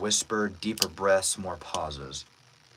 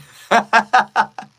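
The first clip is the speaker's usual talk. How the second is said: loud, high burst of laughter; big amused laugh; real laugh; 12 semitones higher, 12 dB louder than usual. Real, not acted. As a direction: crack up out loud. Joking with the chat.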